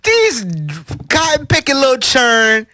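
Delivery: high, very loud incredulous rant; worked-up, high-pitched shouting; loud amused outburst. Real, not acted. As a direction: burst out high and loud straight from a laugh. Loud laughing rebuttal after big laugh.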